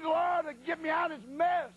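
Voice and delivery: high, urgent plea to the Lord; pleading prayer. Retold or imitated. Preacher stuck in ladies' room pleading with God. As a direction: beg the Lord for rescue. Frantic prayer trapped in stall.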